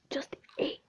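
unvoiced whispered brag; whispering. Real, not acted. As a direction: whisper it smugly.

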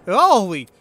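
loud, sudden startled cry; startled yell. Real, not acted. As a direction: jump at a scare. Jump scare when eyes open.

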